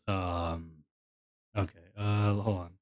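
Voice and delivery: low, soft seductive murmur; sexy man voice. Pretend. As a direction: drop into a sultry low voice. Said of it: "Tumblr sexy man voice".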